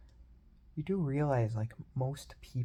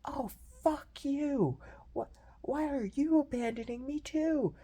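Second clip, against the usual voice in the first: much higher, slow whiny outburst; mock selfish whine; 13 semitones higher than usual. Imitating a friend who lashes out at the friends leaving him, high whine. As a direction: play the self-pitying friend lashing out, high and drawn out. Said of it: "and I was just like".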